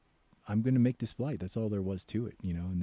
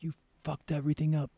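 mild mock yell; 6 semitones higher than usual. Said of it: "he's yelling at me".

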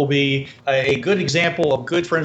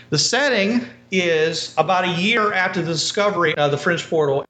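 ordinary talk

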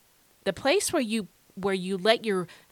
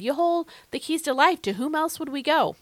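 Peter quoted with fervor; 7 semitones higher than usual. Retold or imitated. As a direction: voice Peter's earnest plea. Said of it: "As Peter said".

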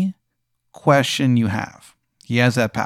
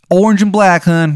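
high, loud, hammed-up Maryland accent; a bad Maryland accent pitched way up; overdone Maryland accent, loud and high; terrible Maryland accent; 8 semitones higher, 7 dB louder than usual. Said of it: "His accent is so bad".

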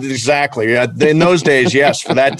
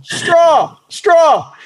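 high, falling call across a crowd; mock yell, pitched way up; yelling for attention. Dad hollering at the railing for a ballplayer's attention, yelling. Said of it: "he starts yelling".

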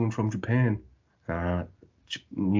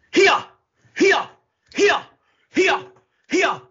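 short, high, loud kiai cries; sharp high-pitched karate shouts in imitation; mock karate yells; 7 dB louder, slower than usual. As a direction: yelp like a martial artist striking. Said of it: "Karate noises? Really?".

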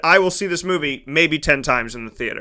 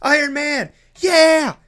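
high-pitched imitation of an excited child; mock kid cheering at superheroes; 7 semitones higher, slower than usual. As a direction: call out like a kid at the movies. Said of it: "Even the kid behind me that was like".

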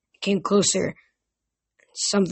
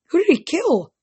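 much higher, sudden question; higher startled ask; 10 semitones higher, 5 dB louder than usual. A narrator's reading. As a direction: blurt it out in shock. Percy startled by murder news.

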